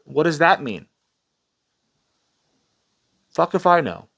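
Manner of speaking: ordinary delivery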